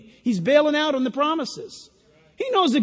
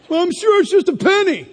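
mock dismissive, much louder and higher; dismissive kid; 6 semitones higher, 8 dB louder than usual. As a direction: brush it off like a know-it-all kid.

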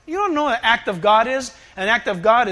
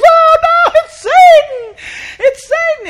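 much higher, loud hammed-up terror; mock panic; 10 dB louder, slower than usual. Everyone panicking at Satan's roar, hammed-up fear. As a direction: shriek it like a scared crowd. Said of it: "And everyone goes".

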